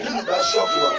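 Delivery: high, shouted demand for the small bottle; shrill demanding mourner. Retold or imitated. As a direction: squeal it like a greedy mourner. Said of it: "He said".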